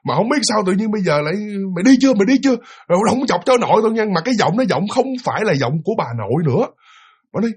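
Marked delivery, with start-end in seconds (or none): 1.7-4.0 high, loud woman's scold; shrill-free loud grandmother yelling. Acted. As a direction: drive the spirit off with a shout. Grandmother ghost scolding again.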